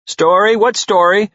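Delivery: called out excitedly. A narrator's reading. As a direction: pounce on the word story. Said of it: "the garage door called out".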